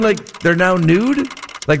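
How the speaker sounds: ordinary talk, nothing marked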